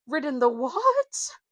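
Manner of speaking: horsey. Acted. Neigh-like horse.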